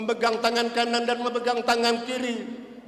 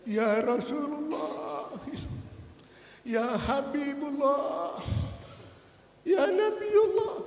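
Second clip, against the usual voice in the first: slow, softer, voice worn out from crying; hoarse weeping voice; 3 semitones higher, 5 dB quieter, much slower than usual. Acted. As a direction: let the words barely come out, spent. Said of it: "suaranya habis, suaranya parau". (suara parau dan habis karena menangis)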